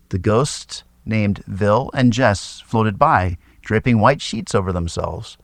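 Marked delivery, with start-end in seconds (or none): none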